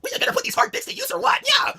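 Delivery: very high, loud lewd squeal; squealing wife. Acted. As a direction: scream it out like a wild wife.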